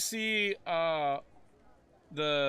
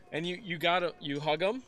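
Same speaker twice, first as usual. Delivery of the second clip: usual speaking voice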